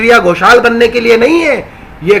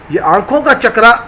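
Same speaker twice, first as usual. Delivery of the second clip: normal talking voice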